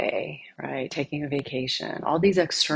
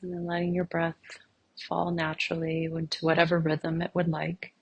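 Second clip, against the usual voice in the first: soft, unhurried meditation voice with long pauses; guided meditation; 4 dB quieter than usual. Real, not acted. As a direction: gently guide listeners inward, leaving silence between phrases.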